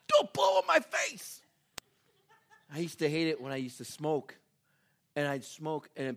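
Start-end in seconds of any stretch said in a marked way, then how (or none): 0.1-1.4 very high-pitched scolding squawk; hammed-up high outburst; mock outraged yell. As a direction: protest in comic outrage. Yells at friend who burped.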